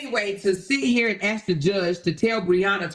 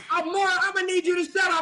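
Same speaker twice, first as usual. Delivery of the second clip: pitched-up, fast judge order; stern judge; 7 semitones higher, faster than usual. Retold or imitated. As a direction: play the judge cutting her off. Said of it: "The judge be like".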